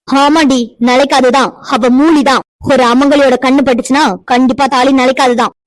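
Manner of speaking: angry shout. Acted. Mother-in-law yells at Pavithra, furious. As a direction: hurl it at her in fury.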